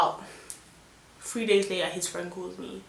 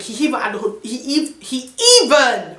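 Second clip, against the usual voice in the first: flustered anger; 4 semitones higher, 5 dB louder than usual. Real, not acted. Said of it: "can you see how mad I am?".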